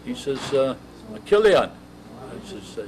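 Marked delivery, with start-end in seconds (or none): 1.1-1.7 loud, higher call of the name. Retold or imitated. Skipper summons the seasick clerk: loud, higher call. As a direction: summon him sharply and loud, pitched up.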